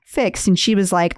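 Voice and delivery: ordinary talk, nothing marked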